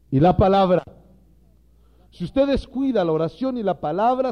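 loud, emphatic pulpit preaching; fiery sermon voice, hammering each phrase; preaching at full force. Acted. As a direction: drive the point home to the ministers.

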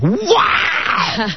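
high-pitched imitation of thrilled kids; mock kid "wow", cried out high; awestruck kids. Dad mimicking kids told about a TV star, awestruck squeal. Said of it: "The kids are like".

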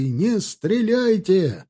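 high, drawn-out appeal; slow, lifted shout; shouted plea. A narrator's reading. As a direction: cry out to stop the firing. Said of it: "прокричали они".

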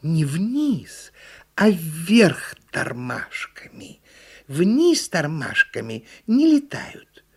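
measured turtle correction; correcting turtle. A narrator's reading. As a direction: set it straight as a pedantic little turtle. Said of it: "поправила её черепаха".

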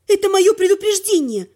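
indignant comeback; angry warning. A narrator's reading. Heroine snaps back at dragon.

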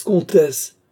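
loud, high call to order; sharp protest. Acted. King interrupts the ranting countess, loud protest. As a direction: cry out to cut her off.

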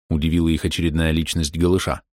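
ordinary delivery

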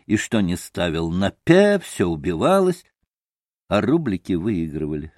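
plain reading voice

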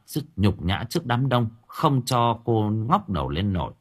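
ordinary delivery

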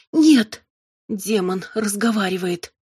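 plain reading voice

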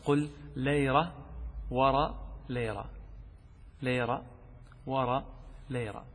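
"ليرة ورا ليرة" spelled out slowly word by word; slow, deliberate tongue-twister demo. Shown as a demonstration. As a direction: draw out each "ليرة" with pauses between. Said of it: "قلها خمس مرات بدون ما تغلط بسرعة".

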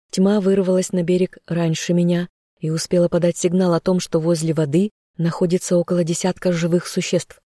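ordinary delivery, nothing marked

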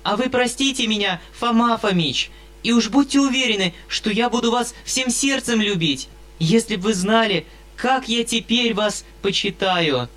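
put-on high-pitched eager girl; eager young girl. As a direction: apologise sweetly and eagerly. Sashenka's girlish apology.

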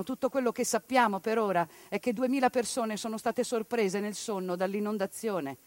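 usual speaking voice

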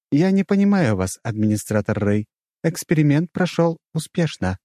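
mostly high, formal system voice; high computer-assistant voice. A narrator's reading. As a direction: report matter-of-factly as the artificial intelligence. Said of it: "раздался голос ИИ".

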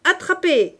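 loud, high shout; high-pitched, forceful call; defiant cry. A narrator's reading. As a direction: yell it up at the sky. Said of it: "il cria".

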